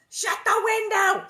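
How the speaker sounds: high, loud urgent command; urgent order. A narrator's reading. Ma ordering Laura to shut the window, loud. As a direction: snap the order out fast. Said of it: "said Ma".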